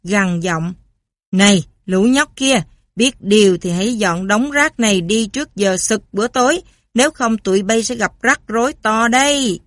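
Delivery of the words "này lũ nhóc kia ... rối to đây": gang boss, louder, menacing. Acted. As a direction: threaten the kids like a mob boss. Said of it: "Trùm băng đảng, gằn giọng". (trùm băng đảng gằn giọng, to)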